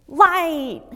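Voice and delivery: put-on loud, high child's cry of "Light!"; toddler shouting light. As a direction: call out "Light!" like an excited little daughter spotting it in the store. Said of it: "She goes".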